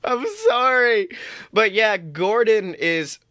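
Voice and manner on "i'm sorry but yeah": ordinary delivery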